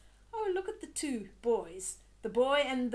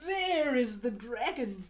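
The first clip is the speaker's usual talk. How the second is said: plain reading voice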